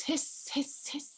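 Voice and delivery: soft whispered hiss; snake hiss. Retold or imitated.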